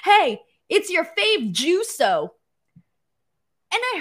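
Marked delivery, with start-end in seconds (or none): 0.0-2.3 slow, higher mock Heyman greeting; imitating Heyman. Imitating Paul Heyman greeting Jimmy, slow and smug. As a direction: drawl it out like a smug manager.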